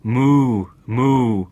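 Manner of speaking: loud, drawn-out moos; a storyteller's loud cow moo. A narrator's reading. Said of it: "And the cow mooed".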